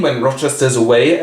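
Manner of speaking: ordinary talk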